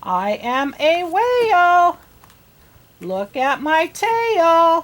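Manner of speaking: a whale showing off its flukes. Acted.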